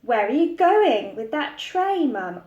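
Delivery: lightly voiced little girl; child's voice. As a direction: voice Laura as a curious child. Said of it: "asked Laura".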